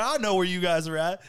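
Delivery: high, hammed-up excited friend; mock eager reply. Host imitates friend who knows where they are, mock eager reply. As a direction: imitate a buddy who's all in.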